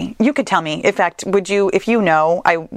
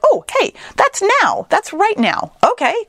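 excited; 12 semitones higher than usual. Acted. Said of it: "your subconscious brain is like".

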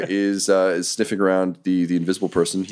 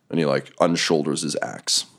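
ordinary talk, nothing marked

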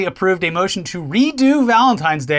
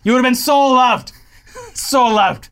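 tender mock-polite voice, higher and louder; polite Canadian; 7 semitones higher, 3 dB louder than usual. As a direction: gush sweetly like a kind Canadian. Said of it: "Very polite".

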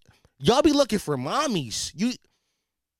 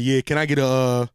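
much lower, put-on ordering voice; deep voice; 7 semitones lower than usual. Shown as a demonstration. Man ordering food in public, in a deep voice. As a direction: drop right down into a deep voice. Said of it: "I put the deep voice on".

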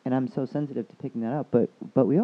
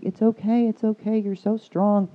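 raised, louder pitch for a consoling doctor; high-pitched mock consoling; imitated urgent reassurance; 10 semitones higher, 7 dB louder than usual. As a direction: retell the doctor soothing someone in tears, high and insistent. Doctor consoling the crying patient, retold.

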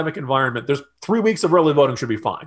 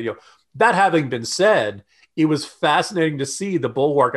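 ordinary talk, nothing marked

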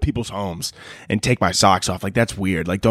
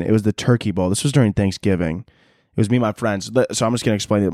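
normal talking voice

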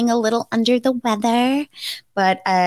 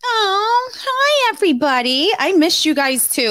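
bubbly greeting; 10 semitones higher than usual. Real, not acted. Greeting the fans.

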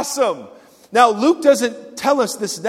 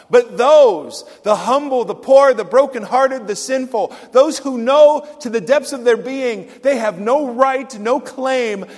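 rising, louder preacher's list; impassioned sermon build; preaching; 4 semitones higher, 5 dB louder than usual. Acted.

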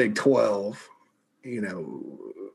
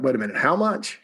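mock greedy surprise; 8 semitones higher than usual. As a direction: play the school perking up at how much money, a hammed-up double take. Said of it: "they were like".

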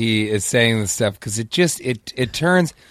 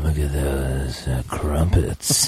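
acted whisper voice; 10 semitones lower than usual. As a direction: drop low and drag it out. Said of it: "that was your finest acting role".

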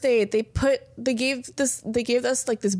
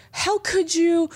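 higher-pitched mock outrage; angry; 6 semitones higher, much slower than usual. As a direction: act out an indignant, accusing message. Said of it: "messaged me angry".

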